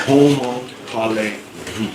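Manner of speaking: "Comment allez-vous?" drawn out slowly, each syllable apart; slow the French right down. Shown as a demonstration. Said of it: "if they say it slower".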